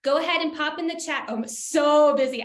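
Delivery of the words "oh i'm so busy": "so" stretched long and loud; drawn-out SO. Retold or imitated. Speaker reading an attendee's chat reply, "so" exaggerated and stretched. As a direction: lean hard on "so", dragging it out. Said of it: "I like the "so".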